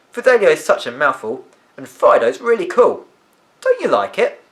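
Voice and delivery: put-on a lively girl greeting her two friends, high and loud; lively girl's voice. A narrator's reading. Olivia continues.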